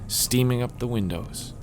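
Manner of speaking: a hint of a nostalgic character; wistful former romance writer. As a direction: play a writer reminiscing about better days. Reader plays Amy, novelist.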